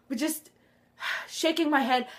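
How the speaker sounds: ordinary talk, nothing marked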